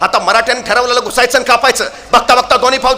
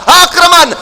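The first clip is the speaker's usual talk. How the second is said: loud, higher battle command; roaring war cry; 6 semitones higher, 5 dB louder, slower than usual. Acted. Bahadur Khan roars the attack at his army, loud war cry. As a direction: bellow the order to attack. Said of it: "कडाडला Bahadur Khan".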